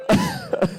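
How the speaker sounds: a sudden high sob breaking through; choked sob. Real, not acted. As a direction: let one high sob escape mid-sermon. Weeping during majlis recital.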